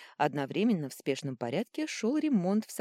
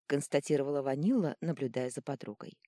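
ordinary delivery

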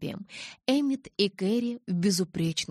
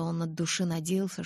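ordinary delivery, nothing marked

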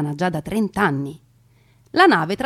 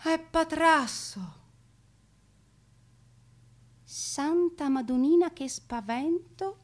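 relieved Venetian woman; 6 semitones higher, 4 dB quieter than usual. Acted. Venetian woman relieved after fright.

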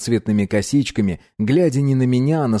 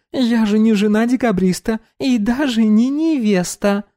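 put-on bright high young woman; high girl voice; 11 semitones higher than usual. Dominika voiced.

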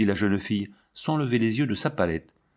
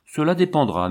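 usual speaking voice

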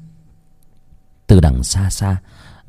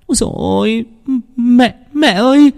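high, frightened cry for mother; panicked cry. Acted. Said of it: "Hiền hốt hoảng".

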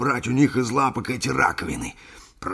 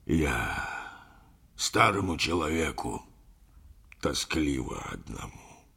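low, slow, halting murmur; murmured, choked-up confession; 6 semitones lower, slower than usual. A narrator's reading. Lonely old bachelor confides in his friend, murmuring with emotion. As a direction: sink low and pause, fighting back emotion. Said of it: "пробормотал капитан, преодолевая своё волнение".

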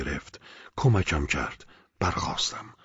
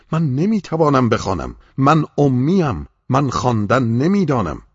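higher and louder, panicked cry that he cannot read; frightened protest; 7 semitones higher, 6 dB louder than usual. A narrator's reading. The seized man cries out to the angel in fear. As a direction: plead in terror, voice straining up.

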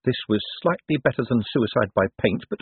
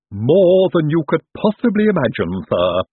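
gleeful henchman, higher and louder; happy Igor; 7 semitones higher, 5 dB louder than usual. A narrator's reading. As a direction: promise it with eager relish. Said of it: "said Igor happily".

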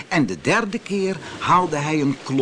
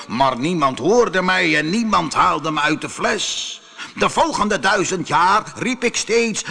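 raised, dramatic genie voice; aggrieved genie; 5 semitones higher, 3 dB louder than usual. Acted. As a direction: tell the old grievance as an imposing spirit. Genie continues his story. (verongelijkte geest die zijn verhaal doet)

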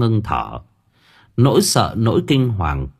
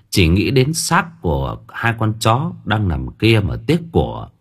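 ordinary delivery

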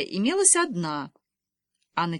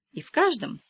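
каждом lifted higher in pitch; stress on каждом; 7 semitones higher than usual. A narrator's reading. Father underlines a detail for mother, stressing каждом. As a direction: put weight on каждом with raised pitch. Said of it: "подчёркиваю".

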